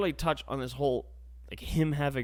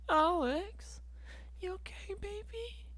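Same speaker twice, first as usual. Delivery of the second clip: put-on much higher, soft baby-talk coo; mock cooing; 6 dB quieter than usual. Cooing at himself about sad times.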